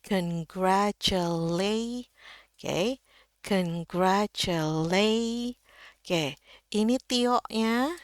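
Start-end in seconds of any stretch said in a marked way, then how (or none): 0.1-2.1 "Congratulate" très lent, montant sur "late"; étirer chaque syllabe, "late" au plus haut; ton culminant sur LAY. Shown as a demonstration. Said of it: "lay paling tinggi nadanya". 3.4-5.6 "Congratulate" très lent, montant sur "late"; étirer chaque syllabe, "late" au plus haut; ton culminant sur LAY. Shown as a demonstration. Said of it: "lay paling tinggi nadanya".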